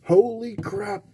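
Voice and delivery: quiet awe rising in the voice; amazed exclamation. Real, not acted. Collector admiring the puck again, hushed amazement.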